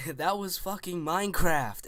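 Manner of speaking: hammed-up angry retort; imitating a friend snapping back; flipping out. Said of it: "Sub-Zero flips out".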